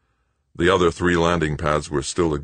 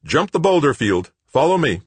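commanding over radio; 12 semitones higher, 5 dB louder than usual. A narrator's reading. Brenard ordering over radio.

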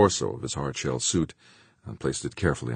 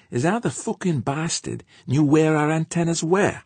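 George, spooked spaceman; 5 dB louder than usual. A narrator's reading. Weary spaceman puzzles over sabotage with crewmate, high character voice.

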